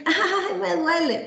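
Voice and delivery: high, put-on whimper of a pretending child; child faking pain. Child faking pain to the doctor, high theatrical whimper. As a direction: ham up a fake little "ay, me duele". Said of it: "está fingiendo".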